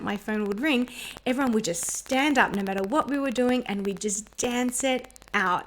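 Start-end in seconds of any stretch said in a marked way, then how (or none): none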